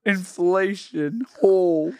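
slow, drawn-out diva impression. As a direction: stretch the vowels like a pop diva. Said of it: "we're gonna retire Miss [name]".

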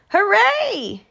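high, celebratory yell; short jubilant shout. A narrator's reading. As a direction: cheer it out. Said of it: "yelled Bear".

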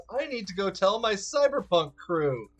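excited. Retold or imitated. Excited retelling of own thought.

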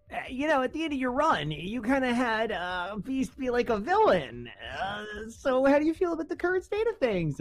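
high-pitched livestream host impression; Agent M. Podcaster imitating a livestream host questioning a comics writer, high-pitched impression. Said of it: "Agent M was like".

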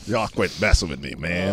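a hint of a cool dog; Rocco voice. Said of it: "Rocco's a soul brother".

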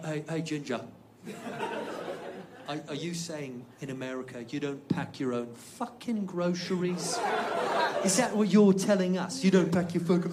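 quiet British disbelief, building at the end; British heckler. Retold or imitated. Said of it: "the same guy pipes up and he goes".

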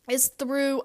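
talking through hiccups. Real, not acted. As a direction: hiccups breaking the words. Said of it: "Excuse me, I have the hiccups".

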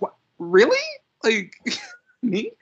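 high surprised squeal; mock surprise. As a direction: play it shocked and disbelieving. Acted movie quote.

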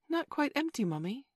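put-on high little-boy voice. A narrator's reading. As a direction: pipe up high like a child answering mummy. Small boy answers his pretend mother.

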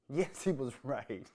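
loud, slow, breaking into laughter; laughing admission. Real, not acted. Admitting to listeners [name] was right, laughing loudly. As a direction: crack up while conceding the point.

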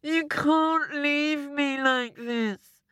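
high, slow pleading wail; hammed-up despairing husband; crying out. A narrator's reading. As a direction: beg desperately, dragging it out. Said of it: "cried Mister Smith".

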